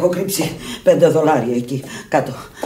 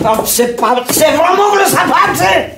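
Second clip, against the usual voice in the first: much higher and louder, raging; furious shouting; 11 semitones higher, 5 dB louder than usual. Acted. Sick woman yelling at her friend in fury. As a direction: scream at her to shut up.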